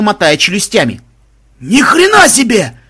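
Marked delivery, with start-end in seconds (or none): 1.6-2.8 high, loud shocked yell. A narrator's reading. Banzai watching the rabbit attack, shocked yell. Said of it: "заорал Банзай".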